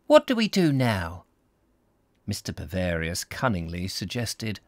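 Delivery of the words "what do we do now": character dialogue. Acted. Voicing Desiree's question in story.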